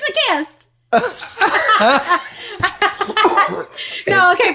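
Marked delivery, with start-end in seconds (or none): 0.9-4.0 long high laugh; burst of laughter. Real, not acted. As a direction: break into laughter. Laughing at the chaotic planning.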